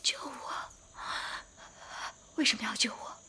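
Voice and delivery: breathy hushed whisper, almost no voice; suspicious whispered question. Acted. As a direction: ask it under the breath.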